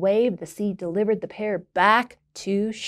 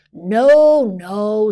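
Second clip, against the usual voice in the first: sudden loud no; grumpy neighbor stopping the wish; loud interruption; 9 dB louder than usual. Acted. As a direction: cut her off loudly. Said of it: "Swashby interrupted".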